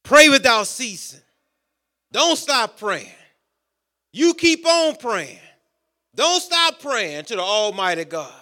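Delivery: ordinary talk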